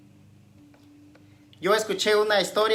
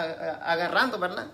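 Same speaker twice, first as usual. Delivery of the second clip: usual speaking voice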